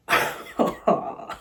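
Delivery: breathy, unvoiced laugh. Real, not acted. Storyteller laughs off her own rant about the date, breathy laugh.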